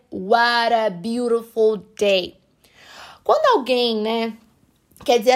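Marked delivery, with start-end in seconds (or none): 0.1-2.4 "What a beautiful day" with both Ts flapped, slowly; American flap T. Shown as a demonstration. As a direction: drawl "What a" as "wara" like an American. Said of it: "Um americano falaria". (sotaque americano, T de "What" e "beautiful" como R)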